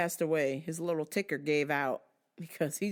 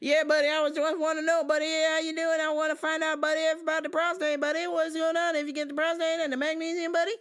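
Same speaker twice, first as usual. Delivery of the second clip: high, loud goofy buddy voice; annoying customer; 11 semitones higher, 7 dB louder, faster than usual. Retold or imitated. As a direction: chirp it like an overeager regular. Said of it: "always calls me buddy".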